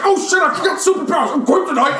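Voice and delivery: very high, loud mock panic; Batman. Friend retelling the fight, high mock-panicked shout. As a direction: shout it in a silly high parody voice. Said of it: "Batman's like".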